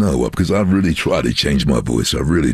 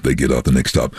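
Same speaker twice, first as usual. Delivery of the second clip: usual speaking voice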